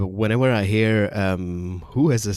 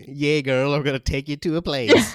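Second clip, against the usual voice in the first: put-on young woman's voice; 4 semitones higher than usual. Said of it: "in a twenty-three-year-old ginger lady voice".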